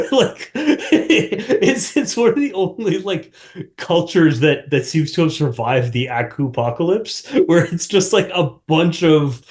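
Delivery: high-pitched, giggly delivery; amused, laughter breaking into the words; laughing while talking. Real, not acted. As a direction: crack up at your own theory. Cracking up at own theory.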